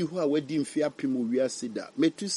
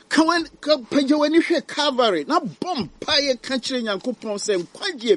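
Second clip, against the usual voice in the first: preaching at full force; 10 semitones higher than usual. Acted. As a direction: urge them to look to the cross. Altar call to the cross.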